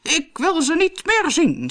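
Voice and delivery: high, slow wail; hammed-up royal distress; distressed king. A narrator's reading. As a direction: refuse in a drawn-out, high complaint. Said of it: "zei de koning".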